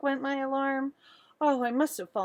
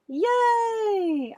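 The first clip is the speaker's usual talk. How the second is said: high, loud joyful yay; excited cheer; 12 semitones higher, 7 dB louder than usual. Acted. Girl cheering that her tooth fell out, high and loud. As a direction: cheer like a thrilled little girl.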